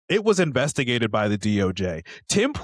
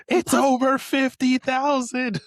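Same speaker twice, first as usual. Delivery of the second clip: much higher, louder, slow cartoon voice; anime parody voice; 6 dB louder, slower than usual. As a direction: ham it up like an anime girl.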